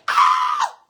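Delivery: sudden high-pitched shriek of glee; a gleeful victory scream; triumphant shriek. Real, not acted. Triumphant outburst after checkmate.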